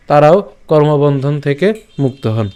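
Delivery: ordinary delivery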